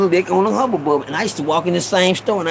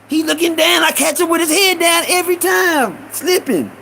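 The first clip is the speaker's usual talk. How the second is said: high, worked-up incredulity; baffled disbelief; 8 semitones higher than usual. Retold or imitated. Young thief wonders how the man catches him, high and incredulous. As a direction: puzzle it out in a rising, pitched-up voice. Boy baffled at being caught.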